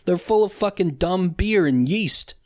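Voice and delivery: normal talking voice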